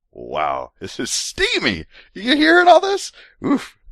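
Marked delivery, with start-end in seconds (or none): none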